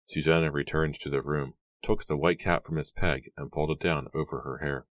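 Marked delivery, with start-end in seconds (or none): none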